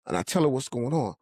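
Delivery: ordinary talk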